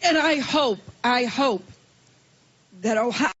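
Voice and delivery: ordinary delivery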